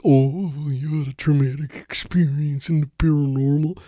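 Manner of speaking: high, sneering taunt; mock the listener like an inner bully; high mocking inner-critic voice. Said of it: "that's the damn voice in the head".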